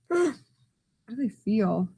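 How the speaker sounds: soft, hushed question. Acted. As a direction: ask it in a hush. Hushed question after gasp.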